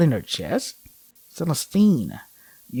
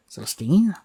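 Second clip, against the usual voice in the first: "Celestine?" rising into a question, a little higher; unsure question; 5 semitones higher than usual. Acted. Turning her around, asking Celestine uncertainly. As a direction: ask "Celestine?" with a lift, as if not sure. Unsure call as she turns.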